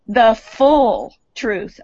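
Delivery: "full" stretched out slowly and weightily; stress on full. Real, not acted. Speaker quotes the House resolution, stress on "full". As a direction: draw out "full" before moving on. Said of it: "underline full".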